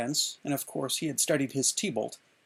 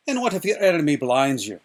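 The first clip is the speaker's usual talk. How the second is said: louder, demanding fencing master; MacPherson challenging; 9 dB louder than usual. A narrator's reading. MacPherson drilling young Inigo, loud and demanding. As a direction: throw the question like a dare. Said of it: "MacPherson once said".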